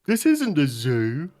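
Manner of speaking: slow, loud ogre voice. Acted. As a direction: rumble it out like an offended ogre. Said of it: "the ogre is like".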